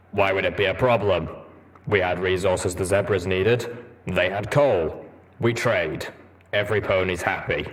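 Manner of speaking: a lightly voiced gruff armored pony, matter-of-fact; a hint of a stern soldier; Steelhooves' plain reply. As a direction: shrug it off calmly.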